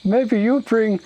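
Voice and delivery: high, slow, loud ogre voice; simple-minded ogre, hopeful. Acted. Ogre pleading for his sad friend Tim, hopeful. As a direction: plod through it like a dim ogre.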